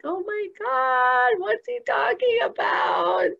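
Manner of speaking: high, slow, tearful cry of disbelief; overwhelmed, sobbing out the words; weeping. Retold or imitated. Retelling sitting before her shaman, weeping aloud. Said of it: "I'm just sitting there weeping".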